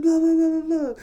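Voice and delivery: high-pitched mimicked rant; mock ranting. Honking trucker ranting on, high mock babble.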